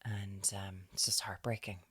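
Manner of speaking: soft, hushed sympathetic retelling. Retold or imitated. To her friend about the struggling mother, hushed and soft. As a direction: murmur it low as if sharing sad news.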